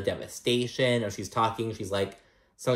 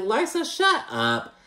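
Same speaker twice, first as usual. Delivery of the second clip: much higher, drawn-out shut up; mock exasperated squeal; 11 semitones higher, slower than usual. Fed-up viewer squealing at Larsa on screen. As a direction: squeal it at her like a fed-up fan. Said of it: "And I'm like".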